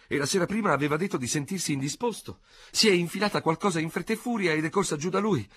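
high, breathless society lady pouring out the story; agitated older lady. A narrator's reading. Léonie telling the general the whole affair, high and flustered.

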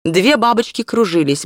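plain reading voice

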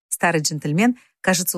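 ordinary delivery

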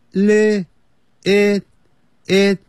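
high, level, separated "li-, it, it"; syllable drill. Shown as a demonstration. Teacher breaks little into parts, "it" held high and short. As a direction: chop out "it" on one high note.

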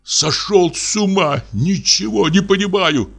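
indignant shouts of a widow at a suitor. Acted. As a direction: challenge him to a duel in fury. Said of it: "возмущённая, потрясает револьвером".

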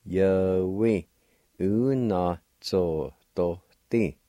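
u-na-zo-do-ti said slowly, syllables apart. Shown as a demonstration. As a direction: draw out u-na-zo-do-ti. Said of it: "And together".

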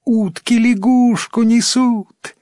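put-on high, stretched-out child's shout; children calling out. A narrator's reading. Village children see ducks carrying a frog, high calling. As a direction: cry it out like an amazed village kid. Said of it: "кричали дети в одной деревне".

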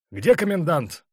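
high, loud, brusque question; sharp demand. A narrator's reading. Colonel to the orderly on arriving at the guardroom, sharp. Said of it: "резко спросил Черняк".

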